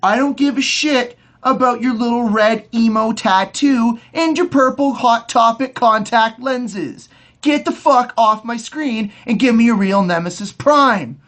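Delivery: angry fan yelling at the screen; heated rant. Real, not acted. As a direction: tell the villain off, furious fan. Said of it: "I am very heated about this subject".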